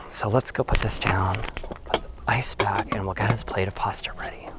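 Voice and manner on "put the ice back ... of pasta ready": whispering. Real, not acted. Quiet setup before dinner.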